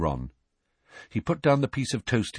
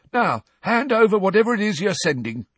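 high, loud demanding caretaker; angry Filch; 12 semitones higher, 6 dB louder than usual. Acted. Filch demanding Harry's letter, loud and high. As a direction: demand it sharply.

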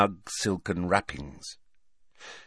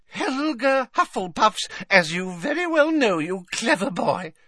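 put-on doting old lady; 14 semitones higher, 3 dB louder than usual. A narrator's reading. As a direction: fuss over him like a grandmother. Old witch praising Tom.

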